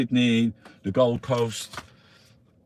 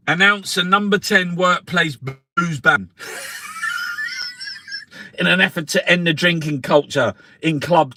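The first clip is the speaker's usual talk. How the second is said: loud, punchy reading of headlines; newspaper read aloud; 5 semitones higher, 7 dB louder than usual. Acted. Reading the Sunday paper out to friends, loud and mocking. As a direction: declaim the headlines to the room.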